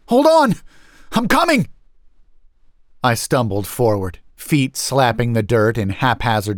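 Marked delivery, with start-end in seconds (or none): 0.1-1.7 high, loud urgent yell; shouting. A narrator's reading. Narrator rushing to save Harriet, high loud shout. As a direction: yell it to someone in danger. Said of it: "I shouted".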